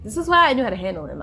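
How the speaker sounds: ordinary delivery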